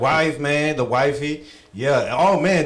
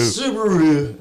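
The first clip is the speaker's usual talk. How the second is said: mock Australian Subaru; much slower than usual.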